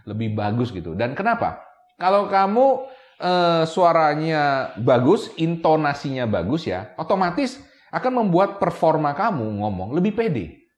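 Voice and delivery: usual speaking voice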